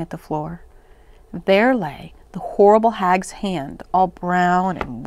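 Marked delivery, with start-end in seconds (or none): none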